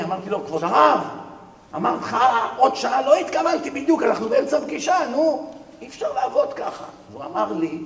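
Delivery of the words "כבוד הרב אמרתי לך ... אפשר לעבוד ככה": irritated scolding. Retold or imitated. Businessman scolding the rabbi.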